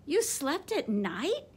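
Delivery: mildly gasped question, slowed; a hint of an astonished gasp. A narrator's reading. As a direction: let the surprise catch your breath a little. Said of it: "gasped another".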